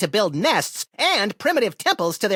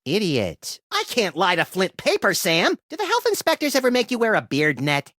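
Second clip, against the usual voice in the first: ordinary talk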